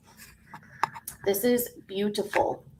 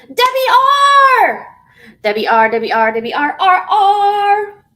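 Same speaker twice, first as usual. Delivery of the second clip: high, loud sing-song name call; excited greeting; 10 semitones higher, 10 dB louder than usual. Real, not acted. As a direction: call out the newcomer's name with delight. Greeting a viewer joining.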